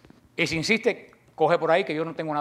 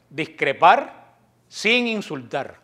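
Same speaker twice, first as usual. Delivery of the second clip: ordinary talk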